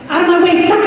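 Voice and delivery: playful road-rage yell quoting a friend; yelling at drivers. Retold or imitated. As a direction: bark it out the window at other drivers. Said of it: "Barbara would yell at".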